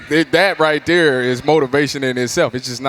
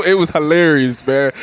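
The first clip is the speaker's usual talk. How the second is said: ordinary talk, nothing marked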